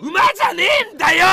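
loud, high snapped retort; angry shout. Real, not acted. Streamer shouting back at a viewer calming him like a horse. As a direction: yell it like a furious correction.

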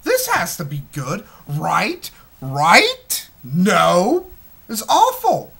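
mock hopeful viewer turning loud and high to disgust; hopeful then disappointed. Moviegoer talks himself into the film, then disgusted. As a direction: plead then crash into dismay. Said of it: "So it's like".

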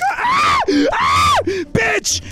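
very high yelps of shock, then a curse; pained scream. Real, not acted. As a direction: scream as the taser bites. Gets tased.

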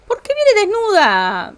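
drawn-out fake indignation; mock outrage. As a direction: act scandalised at the naked guest. Mock outrage at naked Sim.

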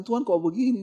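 retold tearful complaint. Husband praying in pain to God, retold tearful plea. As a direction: imitate yourself complaining to God. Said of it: "Nangis saya".

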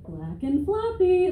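high, slow, flat-out dismissive; deadpan answer. Retold or imitated. Answering nosy strangers about her dog, high and slow. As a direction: drag it out like it's obvious. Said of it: "And I'm like".

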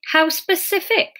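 half-hearted sniping. A narrator's reading. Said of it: "with only a cursory attempt at sniping".

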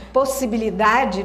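ordinary talk, nothing marked

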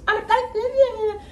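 very high mocking gabble; Cartman. Cartman mocks Kyle's answer, squeaky jeering voice. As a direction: squeal a mocking copy of the other kid. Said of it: "Carmen goes".

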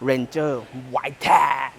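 put-on fierce announcer growl. Shown as a demonstration. Car reviewer hyping the truck's model name, put-on fierce voice. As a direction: make the model name sound menacing. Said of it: "ต้องใส่เสียงให้ดุหน่อยนะ".

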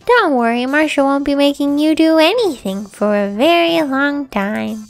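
normal talking voice

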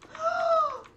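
high, loud frightened gasp between two cries of no; scared scream. Real, not acted. Streamer fleeing the fire in her game, terrified scream. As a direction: shriek in real panic as the fire closes in.

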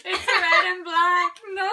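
loud slow sing-song wail; dismayed wail. Real, not acted. Dismay at drawing a bad shot.

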